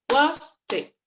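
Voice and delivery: "Plastic" broken into two beats, plas, pause, tic; syllable by syllable. Shown as a demonstration. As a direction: split "Plastic" after plas, each syllable set apart. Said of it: "tiene dos sílabas". ("Plastic" separado en dos sílabas: plas, tic)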